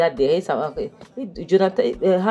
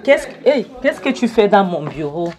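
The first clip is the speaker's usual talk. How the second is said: loud, higher-pitched imitated boss's shout; mock angry shout; 6 semitones higher, 5 dB louder than usual. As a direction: yell at the intruder as if you caught him in your office. Patron trouve intrus à son bureau. (cri de patron en colère, imité)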